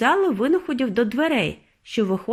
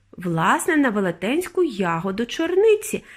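plain reading voice

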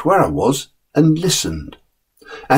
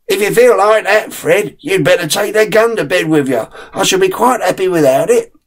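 lightly voiced father, higher and amused; the father teasing his nervous son, a touch higher; father's voice; 7 semitones higher than usual. Father chaffing Fred about city nerves, lightly voiced.

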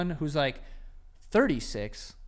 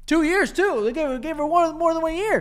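outraged; 11 semitones higher, 8 dB louder than usual. Real, not acted. Outraged at the age gap.

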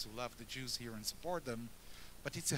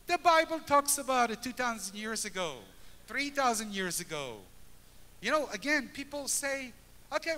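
raised, high preaching voice; rousing preaching; 6 semitones higher, 4 dB louder than usual. Acted. Speaker to congregation about a united Jerusalem, fervent preaching. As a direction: lift the congregation with fervour.

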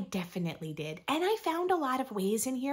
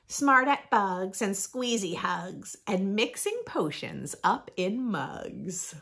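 lively, sing-song picture book reading; reading to children; slower than usual. Acted. Reading a rhyming book to young listeners, bright storytime voice. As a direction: read it like storytime for little ones.

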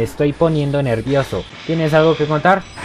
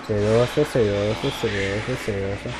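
each repeat lower and softer until it dies away; fading repetition; 4 semitones lower, 6 dB quieter than usual. Acted. Player savouring a word while playing, fading repetition. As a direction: let the word melt off into nothing.

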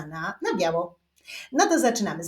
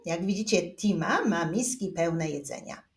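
ordinary delivery

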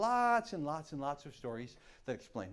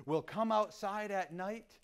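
ordinary delivery, nothing marked

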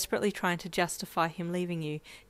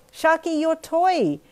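yelling after the car; 11 semitones higher, 9 dB louder, slower than usual. Acted. Child chasing car yelling.

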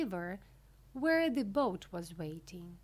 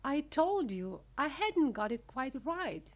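usual speaking voice

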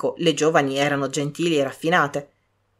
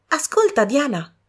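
high bright call, urging her sister; eager call to listen; 8 semitones higher than usual. A narrator's reading. Young woman urging her sister to listen, eagerly.

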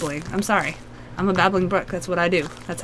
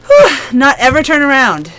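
scared; 5 semitones higher, 7 dB louder than usual. Real, not acted. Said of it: "I'm already freaking scared".

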